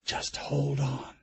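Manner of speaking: whispered comfort to his frightened daughter; soft repeat. A narrator's reading. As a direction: murmur it to keep her calm. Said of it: "he said to her".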